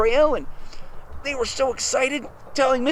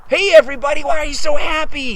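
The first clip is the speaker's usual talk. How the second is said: loud, higher-pitched greeting; cheerful hailing; 4 semitones higher, 8 dB louder than usual. Acted. Mister Bear calls to the happy animals, cheerful hailing. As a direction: call out to the whole group. Father bear hails the group.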